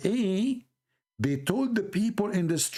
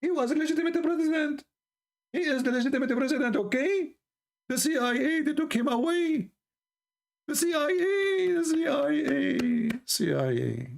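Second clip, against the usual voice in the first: high-pitched mocking protest; mock outcry; 9 semitones higher than usual. Mimicking those who blame the C I A, mock outcry. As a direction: mimic an indignant complainer, pitched way up.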